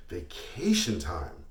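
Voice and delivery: slow, drawn-out disdain on "Vacation time"; scornful echo. Acted. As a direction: stretch it out with contempt. Scornful echo of a piker's question.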